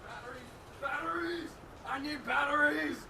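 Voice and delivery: distant shouting from another room; yelled from across the house; calling from far off. Real, not acted. Runs off calling for batteries.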